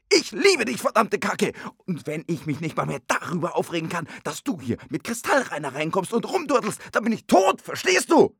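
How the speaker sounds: luid en hoger geschreeuwd; woedende uitbarsting. Acted. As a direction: schreeuw je liefde kwaad uit. Ruzie, hij schreeuwt.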